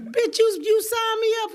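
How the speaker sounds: high, loud mock protest; indignant outburst. Comic to friend who signed her up, indignant retold outburst.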